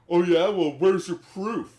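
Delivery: loud, high mocking challenge; challenging. Voicing husband challenging.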